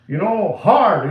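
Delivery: ordinary talk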